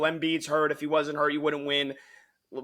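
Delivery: ordinary talk, nothing marked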